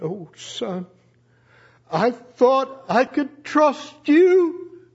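slow, aching disappointed father; heartbroken father. Retold or imitated. Father to son who confessed wrongly, slow hurt disappointment. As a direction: let the hurt slow every word.

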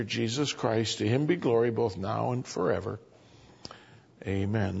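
measured reading from the pulpit; scripture reading. Acted. Preacher reads the epistle to the congregation, measured. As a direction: read the verse with weight.

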